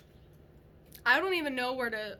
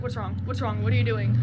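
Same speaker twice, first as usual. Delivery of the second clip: louder, urgent repeated questions; panicked; 3 semitones lower, 7 dB louder, faster than usual. Retold or imitated. Wife pressing her frozen husband, panicked and louder. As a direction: demand an answer in rising panic. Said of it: "I'm freaking out".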